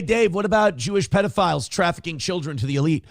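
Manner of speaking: ordinary talk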